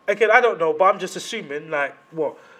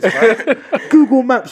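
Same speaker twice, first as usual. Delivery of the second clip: loud laughing shout; 6 semitones higher, 6 dB louder, much slower than usual. Pretend. Imagining revenge for his daughter.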